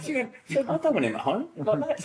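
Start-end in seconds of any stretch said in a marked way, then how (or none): 0.7-1.5 indignant mum. Retold or imitated.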